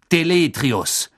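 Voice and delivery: loud, high magical command; called-out spell. A narrator's reading. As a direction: call the spell out commandingly, stretching it. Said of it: "rief Mister Diggory".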